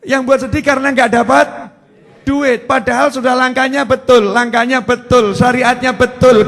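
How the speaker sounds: loud, driving sermon delivery, rising to a peak; full-force preaching. Acted. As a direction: hammer each point home to the congregation.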